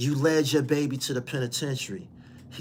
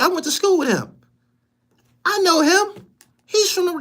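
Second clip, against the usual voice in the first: mocking old classmates; 5 dB louder than usual. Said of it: "motherfuckers gonna say".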